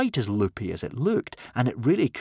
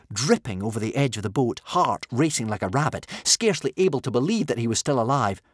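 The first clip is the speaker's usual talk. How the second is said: ordinary delivery, nothing marked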